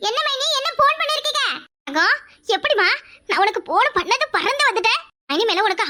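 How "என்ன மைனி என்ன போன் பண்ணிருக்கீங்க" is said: high, bright-eyed, rushing to please; chirpy sister-in-law who came flying; eager sister-in-law. Acted. Sister-in-law arriving at the call, high eager voice.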